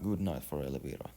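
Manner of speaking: plain reading voice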